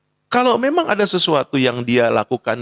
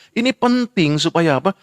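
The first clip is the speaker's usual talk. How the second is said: ordinary delivery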